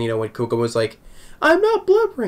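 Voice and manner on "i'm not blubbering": very high falsetto imitation; mock indignant denial. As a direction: protest in a squeaky put-on voice. Imitates character's denial from earlier episode.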